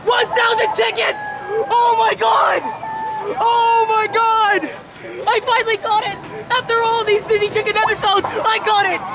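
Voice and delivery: screaming with disbelief at winning; shrieking jackpot winner. Real, not acted. Wins the jackpot in game.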